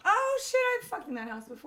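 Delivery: a sudden high yelp of recognition, then laughing; mock shocked outburst. To the Uber passenger watching porn, a mock shocked blurt. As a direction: blurt it out like you just spotted your own house. Imagined reaction to porn in cab.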